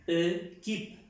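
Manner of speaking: "E, keep" as "euh-kip", in two parts; unaccented French E. Shown as a demonstration. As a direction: model "equipe" wrongly with a schwa first. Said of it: "como esta E normal se pronuncia".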